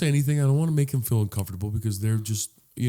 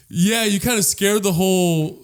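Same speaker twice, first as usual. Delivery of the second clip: louder, higher, politely awkward; employee voice; 5 semitones higher, 5 dB louder than usual. Retold or imitated. Employee telling customer the whole place heard, awkward. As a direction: gently break it to the screamer, projecting.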